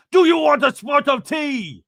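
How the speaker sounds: loud, high, hammed-up British accent; bad British accent. Imitating a friend practicing for the London trip, bad British accent. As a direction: shout it in a terrible fake British accent. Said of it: "First bad accent of the episode".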